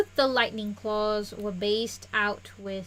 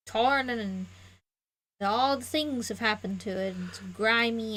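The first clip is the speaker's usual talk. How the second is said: ordinary talk, nothing marked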